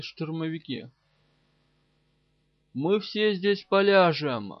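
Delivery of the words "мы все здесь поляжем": loud, high, drawn-out wail; despairing howl. A narrator's reading. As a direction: howl in doom, slowly and loudly. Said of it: "взвыл Кривой". (отчаянный вой)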